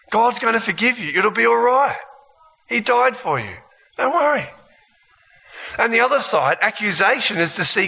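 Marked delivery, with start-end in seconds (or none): 0.1-4.5 high, coaxing false comfort; tempting reassurance. Acted. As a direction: lull them into sin sweetly. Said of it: "temptation would be me trying to see God strictly as love".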